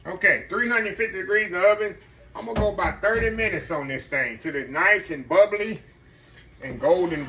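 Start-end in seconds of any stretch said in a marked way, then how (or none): none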